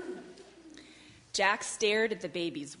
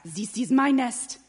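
put-on loud, slow, higher French accent; French duck protest; 5 semitones higher, 8 dB louder, slower than usual. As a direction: claim it indignantly with a French accent. French duck claims the nest.

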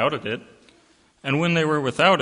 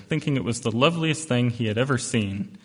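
usual speaking voice

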